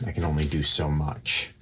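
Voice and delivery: usual speaking voice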